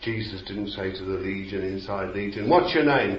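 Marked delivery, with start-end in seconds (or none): 2.5-3.2 mock exorcist demand. Mocking exorcists questioning demons.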